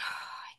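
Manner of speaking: breathy, voiceless sigh put on for a quote; imitated weary sigh. Retelling the editor's reluctance, put-on sigh. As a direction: sigh as if you can't be bothered. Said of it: "she was like".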